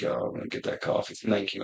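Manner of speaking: hushed, breathless whisper to himself; whispered manifesting. Retold or imitated. Alone in the bathroom, willing a coffee into being, whispered. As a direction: whisper the wish with eyes closed. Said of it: "And I'm like".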